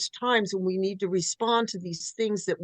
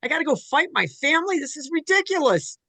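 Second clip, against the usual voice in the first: incredulous Arjuna; 10 semitones higher, 4 dB louder than usual. Retold or imitated.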